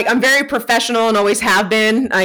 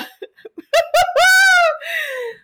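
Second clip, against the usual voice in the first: very high, long laugh; giddy high-pitched laughter; real squealing laugh. Real, not acted. Laughs after telling the excited story.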